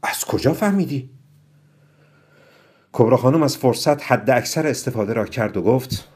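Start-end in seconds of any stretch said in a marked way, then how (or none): 0.0-1.1 higher-pitched surprised question; astonished. A narrator's reading. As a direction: ask it amazed, pitch lifting. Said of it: "با تعجب گفت".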